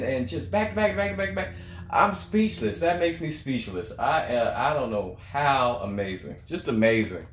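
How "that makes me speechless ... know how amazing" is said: ordinary delivery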